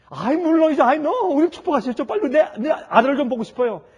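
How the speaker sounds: loud, high eager insistence; eager plea. Acted. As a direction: urge him, excited and impatient. Wife eager, misunderstands husband.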